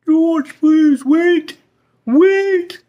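pleading call. Acted. Therapist to departing George, a loud pleading call. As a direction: beg him to come back. Therapist calling after leaving patient.

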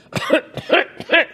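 high pitched mock coughing fit; imitated cough. The coughing accountant in the seminar, a mock high cough. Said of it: "He's going".

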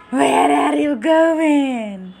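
slow, nasty monster voice; nasty ghoul shout. Acted. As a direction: drag it out like a menacing ghoul. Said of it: "shouted the ghoul in a nasty voice".

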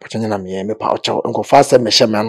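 soft, near-whispered plea; hushed begging. Real, not acted. Guest softly begging the host to believe him, hushed plea. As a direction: beg softly, almost under your breath.

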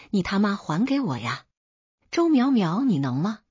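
an understated roar, peaking on the name; mild enraged roar. A narrator's reading. Husband erupting at his unresponsive wife, mildly roared. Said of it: "嘶吼道".

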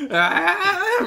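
long, high mock scream; imitated running scream. Imitating someone running at you, mock-screaming. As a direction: draw out a laughing charge cry.

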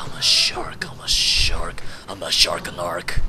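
breathy whispered chant; whispering. Real, not acted. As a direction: whisper it like a secret threat. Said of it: "repeated in whisper".

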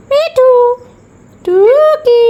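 high, loud sing-out to a pet bird; high playful bird-calling. Real, not acted. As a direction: call the bird in a high, drawn-out voice.

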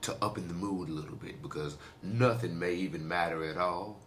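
low, soft radio-host voice; deep sexy voice. Acted. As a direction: purr it like a late-night DJ. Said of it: "in my deep, sexy voice".

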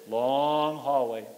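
ordinary talk

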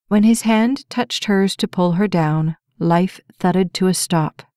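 usual speaking voice

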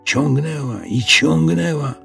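plain reading voice

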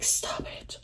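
stunned hushed whisper; star-struck whisper; whispered stop it. Retold or imitated. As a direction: breathe it out in disbelief. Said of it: "And I'm like".

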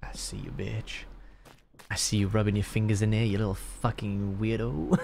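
creepy teasing. Pretend. Player spying on another's odd fingers, creepy teasing.

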